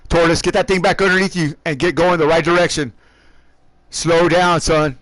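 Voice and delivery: loud, firm radio orders; stern team owner. Real, not acted. Team owner telling Tortoise to calm down, stern. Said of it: "I gotta be a team owner here".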